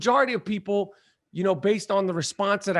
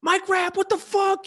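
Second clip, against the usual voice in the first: excited fan greeting; 11 semitones higher, slower than usual. Retold or imitated. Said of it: "they're like".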